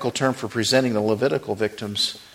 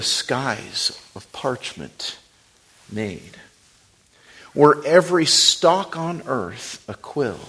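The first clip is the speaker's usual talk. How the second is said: hymn recitation; 3 dB quieter than usual. Acted. Preacher softly reciting a hymn to the congregation. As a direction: hush the words of the old hymn.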